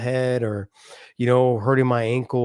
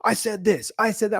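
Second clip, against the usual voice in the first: imitating angry yelling; 8 semitones higher than usual. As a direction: mimic a parent overreacting at the table. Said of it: "They're always at an eleven".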